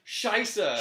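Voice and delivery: mock German accent on Scheiße. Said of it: "Mm, like".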